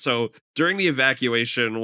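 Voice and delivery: ordinary talk, nothing marked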